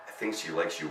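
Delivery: soft, hushed aside; a quiet secret to the bear; whispered. A narrator's reading. Girl reassuring the bear in a hushed voice. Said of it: "whispered Judy".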